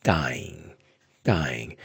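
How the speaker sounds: gaing said with a low falling tone, slow; Burmese word gaing. Shown as a demonstration. Teacher demonstrating gaing to learners, low falling tone. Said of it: "What you get is the word".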